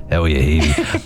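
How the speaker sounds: deep mimicked Dublin greeting; Dublin accent. Host tells listeners how to start texts, mock Dublin accent. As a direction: put on a low Dubliner voice. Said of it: "Please start your message".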